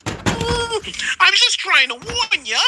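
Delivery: squeaky high slow warning. Retold or imitated. Imitating Gary warning them in a squeaky voice. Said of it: "He's like".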